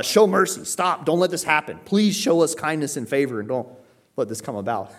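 high, pressing, mostly loud entreaty; over-the-top pleading. Acted. Peter calling upon the Father to stop it, pleading. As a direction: implore with mounting short appeals. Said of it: "over-the-top invoking the Lord".

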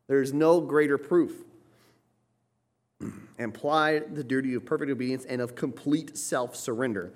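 high, loud preaching at full force; fiery preaching. Acted. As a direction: preach it out to the congregation.

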